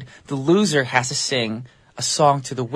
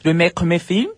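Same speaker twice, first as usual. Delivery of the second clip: higher-pitched, put-on French accent; mock French accent; 5 semitones higher than usual. Host announces a made-up French film genre, mock French accent.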